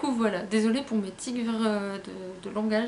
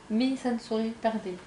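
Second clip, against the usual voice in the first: ordinary talk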